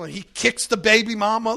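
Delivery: ordinary talk, nothing marked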